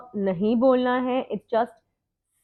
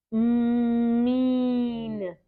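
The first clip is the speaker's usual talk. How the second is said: slow. Shown as a demonstration. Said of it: "slow and then fast".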